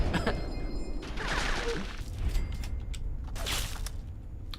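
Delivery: long, high, breathless laugh; wheezing laugh. Real, not acted. Streamer laughs at own dig at a viewer, wheezing laugh. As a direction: dissolve into airy, helpless giggles.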